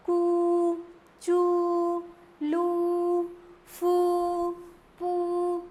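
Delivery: each syllable held with a firm long oo; teacher drilling long ū; strong long oo. Shown as a demonstration. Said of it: "हमें तेज बोलना पड़ता है".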